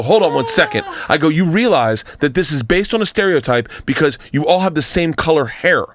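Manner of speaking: a worked-up comic arguing with hecklers, voice climbing; retold angry rant. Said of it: "Now I'm getting fucking pissed".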